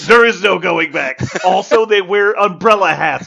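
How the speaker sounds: loud, high, insistent salesman push; mock game pitch. A friend voiced pushing his game, loud and insistent. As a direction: sell the silly idea with full gusto. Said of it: "He's like".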